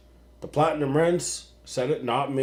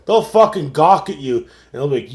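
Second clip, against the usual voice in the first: ordinary talk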